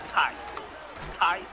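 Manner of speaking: "Thai" as the start of Toyota, like "tie"; Jamaican first syllable. Shown as a demonstration. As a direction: isolate and repeat "Thai", one beat each. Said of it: "so I say Toyota".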